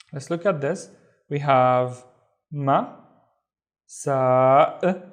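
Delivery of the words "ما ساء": ما then ساء, slow and split apart; syllable by syllable. Shown as a demonstration. Teacher sounding out syllables for students. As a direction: sound out each syllable slowly. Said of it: "This is the hamza with no vowels".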